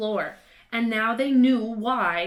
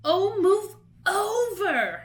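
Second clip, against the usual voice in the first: much higher, loud, slow exasperated snarl; 11 semitones higher, 6 dB louder, slower than usual. A narrator's reading. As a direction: shove him aside impatiently. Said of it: "Hermione snarled".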